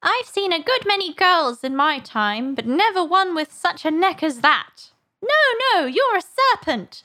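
high, agitated bird voice; scornful pigeon. A narrator's reading. Pigeon disbelieving Alice, scornful accusation.

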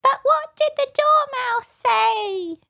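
extremely high, slow juror; tiny high-pitched creature asking. A narrator's reading. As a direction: squeak the question out slowly. A juror asks, squeaky high.

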